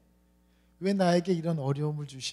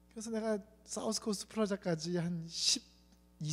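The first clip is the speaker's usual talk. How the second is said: ordinary talk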